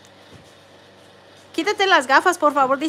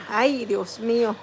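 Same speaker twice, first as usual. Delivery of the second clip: ordinary talk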